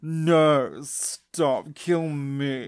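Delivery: loud, drawn-out melodramatic plea; Kari. Acted. Girl begging to be killed instead, loud hammed-up plea. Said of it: "And Kari's like".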